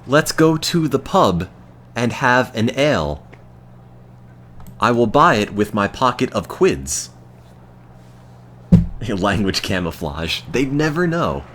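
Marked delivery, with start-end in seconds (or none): 0.1-7.1 playing a cheery British bloke, half-joking; mock British accent. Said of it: "They'll know I'm taking the piss".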